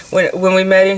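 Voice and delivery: ordinary talk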